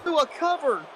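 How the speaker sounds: high tense play-by-play call; excited call. Real, not acted. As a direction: build the suspense on the cover. Calling the top-rope finish.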